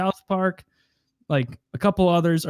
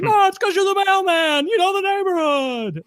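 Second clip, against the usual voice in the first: much higher, louder put-on voice; character impression; 5 dB louder than usual. Friend voicing the flustered reply, squeaky and high. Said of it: "He's like".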